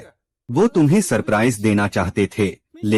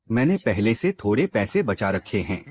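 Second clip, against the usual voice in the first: ordinary delivery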